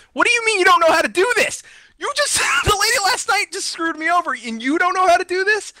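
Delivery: high, incredulous yell at the agent; what do you mean you don't know, near shouting; exasperated outburst. Retold or imitated. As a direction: blow up in disbelief, voice climbing high. Exasperated retort to useless support agent.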